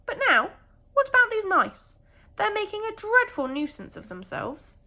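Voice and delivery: put-on indignant old rabbit, fed up with the mice; cross old rabbit. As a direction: complain fussily as the grumpy rabbit. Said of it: "said the rabbit".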